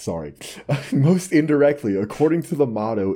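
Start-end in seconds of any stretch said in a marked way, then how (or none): none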